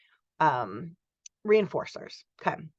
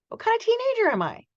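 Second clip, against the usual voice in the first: put-on much higher, wondering teen; high questioning voice; faster than usual. Teacher voicing a teen's identity question, high. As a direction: pitch it up like an anxious teenager. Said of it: "same question said a second time".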